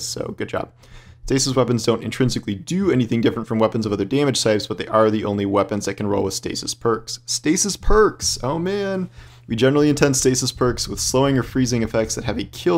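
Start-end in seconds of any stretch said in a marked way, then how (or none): 0.0-0.7 soft unvoiced praise; whispered aside. Real, not acted. Quiet aside. 7.3-9.1 high, slow, thrilled outburst; excited. Real, not acted. Excited reaction to new perks.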